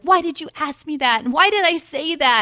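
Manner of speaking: high-pitched imitated complaint; mock protest. Guests after their lightning round, mock protest. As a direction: whine it like a regretful guest.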